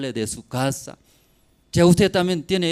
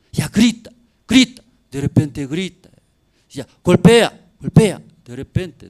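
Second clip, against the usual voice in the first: loud, building description; rising preaching; 4 semitones higher, 10 dB louder than usual. Acted. Preacher describing the possessed girl, getting louder. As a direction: pound out each verb.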